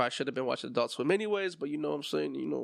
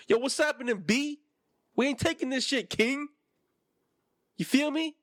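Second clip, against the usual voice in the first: put-on New York accent; 9 semitones higher than usual. Settlers telling the king no, in a hammed-up New York accent. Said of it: "that's how I imagine the settlers, with New York accents".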